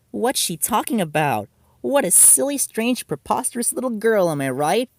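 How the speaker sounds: put-on sweet innocence; fake kindness. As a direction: laugh her off sweetly. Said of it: "faking her kindness".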